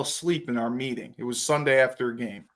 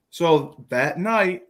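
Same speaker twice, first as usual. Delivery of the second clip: ordinary talk, nothing marked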